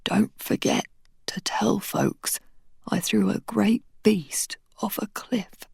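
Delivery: slow, breathless whisper; dying man whispering his last wish. Acted. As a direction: struggle out each phrase. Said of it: "He took a jagged breath".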